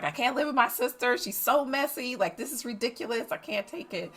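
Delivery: a hint of the exasperated daughter; mother lightly mimicking the daughter; lightly voiced daughter. Daughter complains about messy sister.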